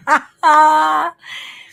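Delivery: high joyful burst of laughter; giddy laugh. Real, not acted. Psychic revealing her new office, giddy laugh. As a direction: laugh out loud with delight.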